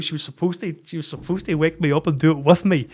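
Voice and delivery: ordinary talk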